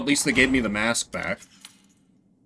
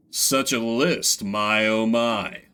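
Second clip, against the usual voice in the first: slow, savouring drawl; captain relishing the charge list, slowly; 3 semitones lower, slower than usual. Acted. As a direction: roll the words with mock delight.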